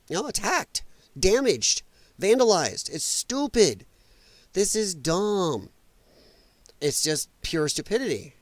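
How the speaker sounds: ordinary delivery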